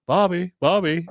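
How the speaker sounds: Hank calling to Bobby through his door, calling out. Retold or imitated.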